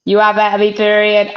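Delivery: ordinary talk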